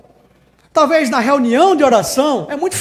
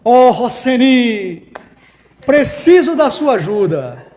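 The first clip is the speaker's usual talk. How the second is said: slow, louder mock-solemn plea to a person; forced prayer voice; 5 dB louder, much slower than usual. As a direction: speak to a friend in pious tones. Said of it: "quem é que fala com as pessoas assim?".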